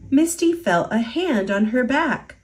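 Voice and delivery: usual speaking voice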